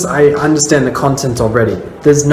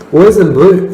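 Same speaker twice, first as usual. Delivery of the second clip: put-on "Boys in blue" with an Australian lilt, rising at the end, a bit louder; 6 semitones higher, 6 dB louder than usual. Shown as a demonstration. Vlogger shows how Australians say the police nickname, Australian accent. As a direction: say "Boys in blue" the Aussie way, stress on boys. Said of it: "but we say it more like that".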